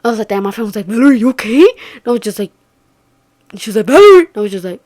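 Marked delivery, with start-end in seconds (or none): 0.9-1.6 louder retold check-in call. Friend checking on frozen Valerie, calling out. 3.8-4.2 high, loud name call. Retold or imitated. Friend trying to rouse frozen Valerie, calling out.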